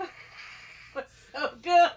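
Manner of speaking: high, loud laughing through words. Real, not acted. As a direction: gasp it out while laughing. Laughing over the story.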